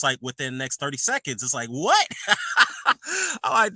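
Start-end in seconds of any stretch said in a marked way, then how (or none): 1.7-2.1 high, loud "What?" with a laugh. Retold or imitated. Fan amazed at the recovery, laughing. 2.1-3.4 high, squeaky burst of laughter. Real, not acted. Vlogger cracking up at the recovery, high laugh.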